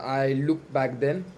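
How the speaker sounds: ordinary talk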